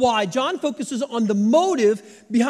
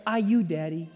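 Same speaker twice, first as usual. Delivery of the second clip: put-on toddler voice; 6 semitones lower, 5 dB quieter than usual. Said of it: "And she'd go".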